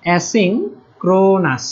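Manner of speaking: Asynchronous drawn out slowly, 'e' sound first; slow demo word. Shown as a demonstration. Teacher repeats the term slowly for students to copy. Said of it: "সেটা হচ্ছে asynchronous".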